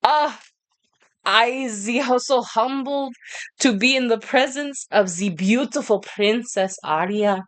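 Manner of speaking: put-on slow, effusive French-accented suitor; attempted French accent. Suitor humbly greeting Princess Arya, slow attempted French accent. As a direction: lay on the humble courtship in a shaky attempt at French. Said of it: "I'm trying to do a French accent".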